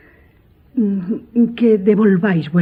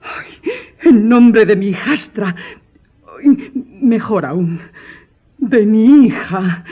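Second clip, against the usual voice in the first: higher, louder and slow, full of fervour; fervent thanks; 4 semitones higher, 4 dB louder, slower than usual. Acted. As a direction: thank him with overflowing feeling. Stepmother thanking the old man fervently. (agradecimiento ferviente, más agudo, fuerte y lento)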